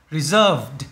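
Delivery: "reserved" with -ed voiced as D; final D sound. Shown as a demonstration. Teacher models the -ed ending for students, "reserved" ending in D. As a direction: end "reserved" on a clear D. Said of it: "được phát âm là D".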